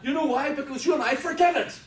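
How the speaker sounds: loud, high-pitched preacher's cry; preaching at full force. Real, not acted. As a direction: proclaim it at full volume to the congregation.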